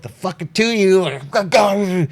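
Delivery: high, far-off barked orders; guard captain barks orders at his men, high and harsh; barking guard captain. Acted. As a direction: bark orders at the two of them. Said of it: "barking orders, yelling".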